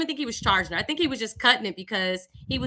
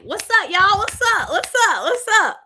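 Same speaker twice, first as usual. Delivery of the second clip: bright, high, loud hellos to the band; calling out cheerful hellos, pitched up; cheery greeting; 8 semitones higher, 6 dB louder than usual. Retold or imitated. Teen greeting the church band, loud and cheery. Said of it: "I used to greet the band members like".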